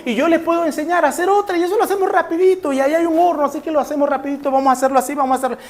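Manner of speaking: a mildly bubbly rush to help; eager offer. Acted. Sister eagerly offering to bake, a bit high and quick.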